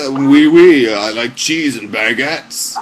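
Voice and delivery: hammed-up French accent, loud start then slow and drawn out. Host showing why French fails, exaggerated French accent. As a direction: do a mock French accent, stretching the words. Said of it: "don't do French".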